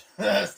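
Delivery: sharp high laugh; a quick delighted laugh, high and loud; burst of laughter. Real, not acted. Delighted fan laughing at his Cat Toad, laughing.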